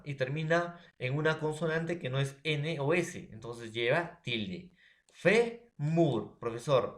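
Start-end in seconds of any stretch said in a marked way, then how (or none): 5.1-6.4 "Fe, mur" split, with FE high and loud; teacher's two-beat demo of "Fe, mur"; stress on penultimate syllable. Shown as a demonstration. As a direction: punch "Fe" hard, pause, then "mur". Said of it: "Última".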